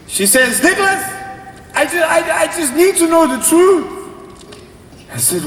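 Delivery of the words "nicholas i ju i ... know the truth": high-pitched, agitated mom voice; mock a cross mother demanding answers; angry mother. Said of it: "She's so angry".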